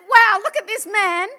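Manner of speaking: a stunned loud wow at the man; amazed exclamation. Retold or imitated. Preacher tells the crowd, loud amazed wow. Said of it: "And I said".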